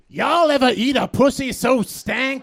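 high, loud, hammed-up raunchy host; raunchy Ellen. Ellen at three PM shouting filth to her daytime crowd. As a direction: belt it like a crude club comic.

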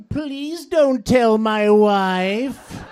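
high, slow, loud desperate plea; pleading whine. Acted. Caught eating in a stall, begging a stranger in a high, slow wail. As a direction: beg slowly, squeaking with panic.

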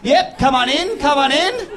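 a cheery fake-friendly welcome, hammed-up; mock welcoming boss. Boss waving Indian guys in, mock cheery voice. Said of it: "She couldn't say to a couple of guys from India".

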